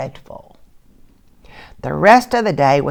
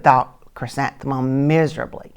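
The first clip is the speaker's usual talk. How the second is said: usual speaking voice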